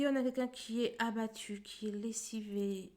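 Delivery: normal talking voice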